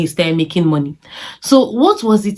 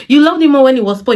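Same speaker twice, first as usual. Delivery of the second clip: higher, louder and faster mockery; sarcastic taunting repeat; sarcastic refrain; 5 semitones higher, 4 dB louder, faster than usual. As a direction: throw her words back at her.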